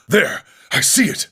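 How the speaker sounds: high, sudden cry of discovery; quick high call of sighting; spotted it. Acted. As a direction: point it out, excited. Spotting the lion.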